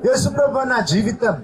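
intense pleading prayer, rising on each plea; fervent prayer. Acted. Preacher prays over the congregation, fervent pleading prayer. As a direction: pour it out to Jesus, pleading.

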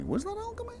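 squeaky question. Acted.

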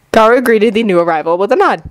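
plain reading voice

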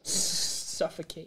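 whisper. Real, not acted. Quiet joke while breath held.

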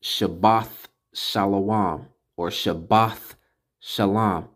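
"Shabbath" slowly with th, before "Shalom"; measured "Shabbath Shalom", th closing "Shabbath"; final th. Shown as a demonstration. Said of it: "So it's pronounced".

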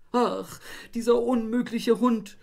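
high, loud tearful wail; tearful outburst. A narrator's reading. Wife in the puddle wailing at the dog, tearful. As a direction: wail it near tears. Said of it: "weinte noch mehr".

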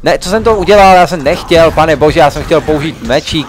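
high, loud shout of real dismay. Real, not acted. Gamer yelling at his own mistake in the fight, dismayed shout. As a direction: cry out at his own blunder. Kills wrong thing by mistake.

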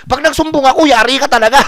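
high, loud teasing threat; mock threat. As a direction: bark a joking warning at the driver. Threatening the driver jokingly.

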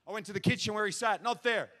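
ordinary talk, nothing marked

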